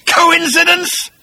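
high drawn-out mocking cry; sarcastic shouting question. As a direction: hurl the question at him.

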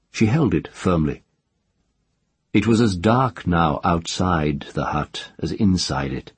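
ordinary delivery, nothing marked

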